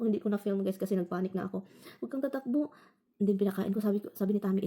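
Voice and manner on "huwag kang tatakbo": coaxing the cat. Retold or imitated. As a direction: gently beg the cat to stay.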